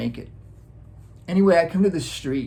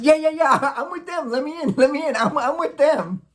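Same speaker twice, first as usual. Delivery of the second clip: put-on hurried kid pleading; 10 semitones higher than usual. Kid begging to be let in.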